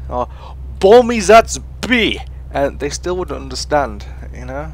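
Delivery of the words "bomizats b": loud, high, slow fake Russian shout; imitating Russian teammates yelling a callout, hammed up; mock Russian callout. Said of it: "And they'd go B".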